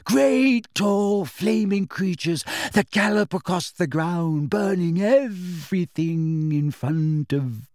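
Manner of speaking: high, rising, wild-eyed excitement; rapt, slightly crazed character voice; mad believer. A narrator's reading. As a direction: revel in the monsters, slowing dramatically until cut off. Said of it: "looked madder than ever".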